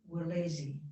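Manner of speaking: ordinary talk, nothing marked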